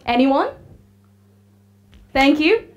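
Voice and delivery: ordinary talk, nothing marked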